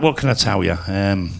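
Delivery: normal talking voice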